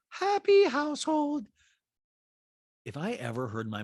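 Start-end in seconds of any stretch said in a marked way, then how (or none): 0.1-1.5 put-on very high-pitched accented caricature; squeaky falsetto mimicry of a reality star; Diana impression. Host mimics reality star in recap, high-pitched caricature. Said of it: "And Diana goes".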